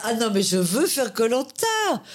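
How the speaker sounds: high, loud burst of enthusiasm; light up at the idea, nearly shouting; excited. Real, not acted. Singer asked about Koh-Lanta, answering with excited enthusiasm.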